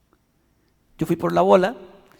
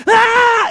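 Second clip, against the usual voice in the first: grito agudo y fuerte; grito de terror; 8 dB louder than usual. Acted. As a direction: chillido aterrado. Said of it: "Ese fue el grito de Isaías". (grito de terror de Isaías)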